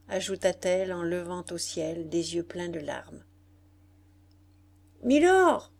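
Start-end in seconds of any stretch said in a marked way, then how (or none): 5.0-5.7 high, loud hail; exclaimed call. A narrator's reading. General hailing the English lord from his seat, loud exclamation. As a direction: call out sharply to get his attention. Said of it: "s'écria le général".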